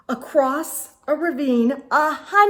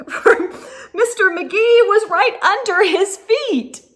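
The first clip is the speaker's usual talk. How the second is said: laughing; 7 semitones higher, 6 dB louder than usual. Real, not acted. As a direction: laugh through it, louder and higher. Laughing at the moose's surprise.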